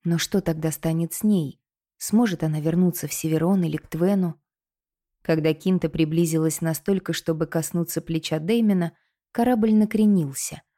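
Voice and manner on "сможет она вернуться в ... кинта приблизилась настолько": ordinary delivery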